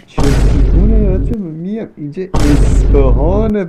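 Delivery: loud, slow and emphatic; loud exclaim. Real, not acted. Gamer reacting to the Isfahan map, loud and slow. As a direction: drag it out loudly, half joking. Excited outburst while playing.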